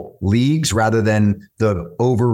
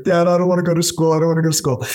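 higher, fast, imitating a whiny tearful kid; mock the tired son's complaint, higher and fast; whining child; 7 semitones higher, much faster than usual. Tired son begs dad to skip school, higher and whiny.

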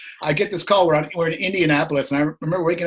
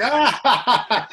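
a startled high yelp of dismay; mock horrified cry; 8 semitones higher, 5 dB louder than usual. Telling the manager about Catwalk, a mock horrified yelp. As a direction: yelp like the offer is a nightmare.

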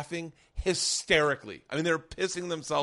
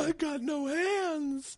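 very high, drawn-out wail; mock wail; 12 semitones higher, much slower than usual. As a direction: stretch it into a comic lament. Joins imagined handless man bit.

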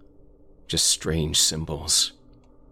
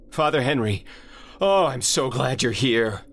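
much higher, louder relieved greeting; relieved call; 9 semitones higher, 3 dB louder than usual. Acted. Sam greets Father Henry in relief, excited call.

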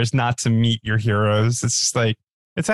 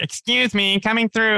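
high nasal imitation of a passing man; pinched nasal "excuse me", higher pitch; 10 semitones higher than usual. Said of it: "very nasal voice".

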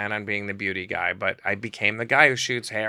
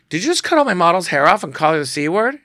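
loud, higher angry challenge; angry confrontation; 8 semitones higher, 9 dB louder than usual. Retold or imitated. Confronting the client about the model's hair angrily. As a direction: confront him hard.

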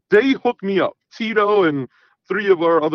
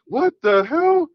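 shocked; 12 semitones higher, slower than usual. Retold or imitated. Shocked at discovering a man.

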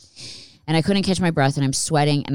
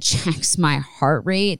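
usual speaking voice

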